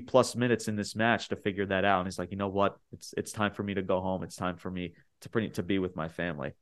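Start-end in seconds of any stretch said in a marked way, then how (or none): 2.3-6.5 soft emotional farewell. Retold or imitated. Quoting emotional wrestler's farewell.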